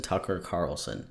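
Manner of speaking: tongue twister, fading. Shown as a demonstration. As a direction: let the third "Tucker Carlson" die away. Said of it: "Tucker Carlson three times fast".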